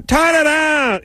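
very high mock yell in Jamaican accent; yelling Jamaican woman. Fed-up Jamaican searcher at traveler, imitated yelling. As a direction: imitate her shouting a garbled order. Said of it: "yelling now".

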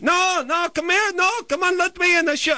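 put-on very high, insistent Israeli-accent caricature; horrible Israeli accent. Neighbor insisting on coming in, mock Israeli accent. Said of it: "It's a horrible accent".

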